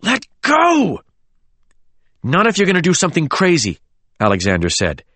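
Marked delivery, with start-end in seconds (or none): 0.0-1.0 very high, straining cry; desperate demand. A narrator's reading. James struggles in Oliver's arms, desperate. 2.2-3.8 high, fast retort; urgent retort. A narrator's reading. Alexander stops James doing something crazy, urgent. Quarrel on the dock.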